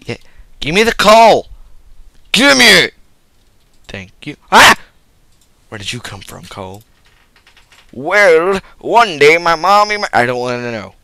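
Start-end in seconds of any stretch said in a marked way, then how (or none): 0.6-2.9 1 loud demand. Acted. Demanding loudly. 7.9-10.1 put-on 1 loud kid voice. Kid telling origin.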